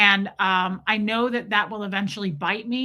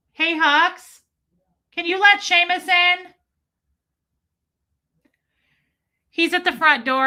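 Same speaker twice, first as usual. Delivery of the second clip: loud, higher-pitched call across the house; 8 semitones higher, 6 dB louder than usual. Real, not acted. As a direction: call to someone in another room. Calls to someone in the house.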